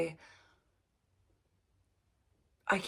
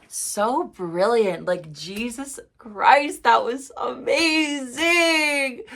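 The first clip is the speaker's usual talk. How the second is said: overwhelmed excitement; 6 semitones higher, 9 dB louder, much slower than usual. Real, not acted. Overwhelmed reaction after the song.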